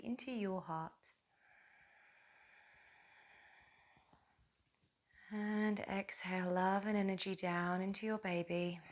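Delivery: soft, unhurried meditation voice with long pauses; guided meditation. Acted. Yoga teacher connecting mother and baby through breath, soft guided meditation. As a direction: guide them gently through each breath, softly.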